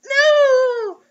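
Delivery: campy horror-heroine cry; mock terror. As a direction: wail it in fake panic. Dramatic reading of horror story.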